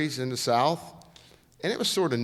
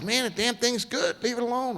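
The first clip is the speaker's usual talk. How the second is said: much higher, insistent; pumped driver on the radio, pitched way up; emphatic radio reply; 11 semitones higher than usual. Retold or imitated. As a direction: tell the crew not to touch it.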